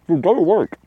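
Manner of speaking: high, playful shoo to the dog. Real, not acted. Shooing the dog away.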